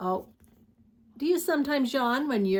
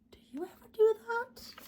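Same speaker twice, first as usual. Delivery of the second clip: a soft whispered question; 12 semitones higher, 4 dB quieter, faster than usual. Real, not acted. Reading to kids, whispered question to the listener.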